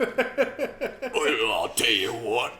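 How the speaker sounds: mock a scary British bloke; hammed-up British accent. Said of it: "like, they're scary".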